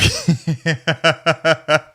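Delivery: high, staccato burst of laughter; a run of quick, high laughs; laughing. Real, not acted. Host cracking up at his schoolhouse story, real laughter.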